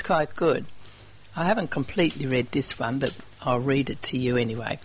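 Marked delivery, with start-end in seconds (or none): none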